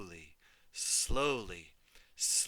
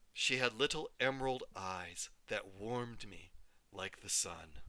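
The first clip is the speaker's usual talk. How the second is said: plain reading voice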